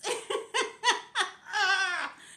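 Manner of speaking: real laugh-cry; loud teary laugh. Real, not acted. Laughs near tears.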